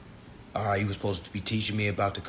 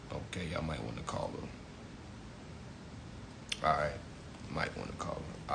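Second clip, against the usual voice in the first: hushed repeat; 10 semitones lower, 17 dB quieter than usual. Real, not acted. Trailing hushed repeat to audience.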